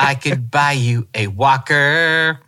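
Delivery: slow, drawn-out teasing jab. Retold or imitated. [name] mocking her older dad, slow and teasing. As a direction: needle him slowly about his age. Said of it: "[name]'s like".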